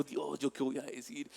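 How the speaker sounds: begging God under his breath; panicked whispered plea. Retold or imitated. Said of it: "Y yo me puse nervioso". (súplica ansiosa casi susurrada)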